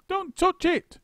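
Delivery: high, loud warning cry; urgent cry. A narrator's reading. Missus Twit warning husband not to touch. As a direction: cry out to stop him. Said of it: "cried Missus Twit".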